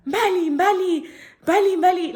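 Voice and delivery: calling out. Retold or imitated. Friends calling her back from outside, loud high calls. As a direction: call her back across the room. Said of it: "yelling out for me at the back".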